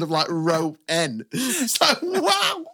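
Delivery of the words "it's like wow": mock amazement. Mock awe at ball in crowd.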